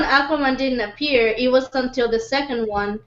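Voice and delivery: ordinary delivery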